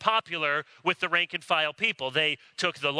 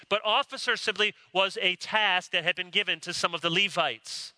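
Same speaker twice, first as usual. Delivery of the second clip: ordinary delivery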